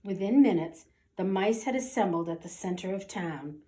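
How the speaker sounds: ordinary delivery, nothing marked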